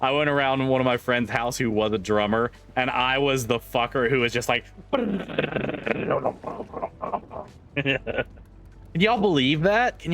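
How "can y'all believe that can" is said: higher, giggly disbelieving question; laughing disbelief. Real, not acted. Streamer laughing to chat about being an obnoxious kid. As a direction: laugh at yourself. Laughing at own childhood story.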